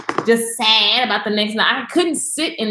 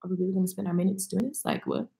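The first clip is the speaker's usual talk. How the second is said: exasperated aside; 4 semitones lower, 11 dB quieter, faster than usual. Retold or imitated. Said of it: "I'm just like".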